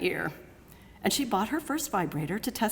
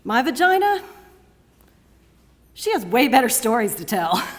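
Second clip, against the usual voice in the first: louder, higher, swaggering performance; exuberant spoken-word boasting; 4 semitones higher, 6 dB louder than usual. Acted. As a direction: revel in each item of the list for the crowd.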